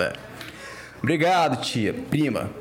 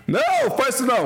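pleading; 10 semitones higher, 3 dB louder than usual. Real, not acted. Begging friend not to throw water.

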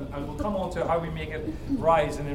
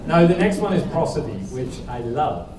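normal talking voice

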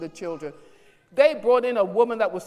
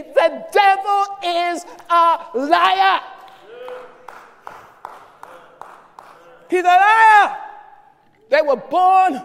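much louder, higher, hoarse shouted preaching; fiery preaching; 6 semitones higher, 7 dB louder than usual. Acted. Pastor denouncing the devil's lies, shouted preaching. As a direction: preach at full force.

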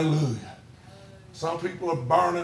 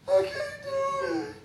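high, squeaky mock sob; mock crying; 7 dB quieter than usual. Coach imitating a crying boy on his team, high tearful whine. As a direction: wail it like a kid putting on tears. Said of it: "He'd cry".

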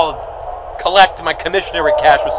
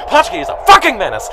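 ordinary talk, nothing marked

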